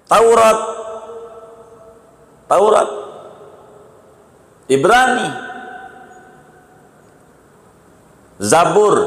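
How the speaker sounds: calling out the scriptures with rising fervour; fervent preaching. Acted. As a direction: proclaim each holy book to the hall. Lists holy books, raised preaching.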